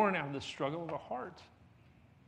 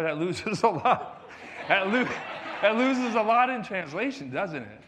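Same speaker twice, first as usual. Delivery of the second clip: laughing through the words; amused, laughing loudly; 3 semitones higher, 7 dB louder than usual. Real, not acted. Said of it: "Ooh, boy".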